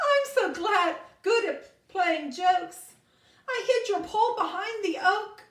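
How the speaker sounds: gleeful goat. Acted. Goat boasts about his prank.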